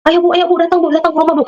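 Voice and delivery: imitated urgent pleading, pitched high; tearful plea. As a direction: retell a high-pitched, tearful begging for help. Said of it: "Desi sudah sampai nangis".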